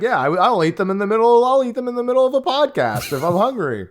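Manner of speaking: hammed-up upbeat ad voice; ad read. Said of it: "It's gonna be used to be like".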